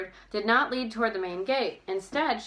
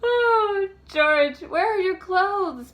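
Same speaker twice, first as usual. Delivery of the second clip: high, loud, slow astonished cry; astonished gasp; 11 semitones higher, 8 dB louder, much slower than usual. A narrator's reading. Bess finding George without clothes, astonished gasp. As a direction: gasp at the sight of her. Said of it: "Bess gasped in astonishment".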